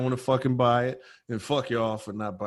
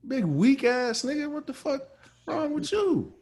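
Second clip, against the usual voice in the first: much higher, scornful woman's jab; mocking buyer; 13 semitones higher than usual. The buyer mocking him for being weak, scornful. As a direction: sneer at the weak man. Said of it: "she looking at me like".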